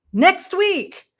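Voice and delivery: jumps high and loud on the words; suddenly loud. Real, not acted. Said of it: "was that loud enough?".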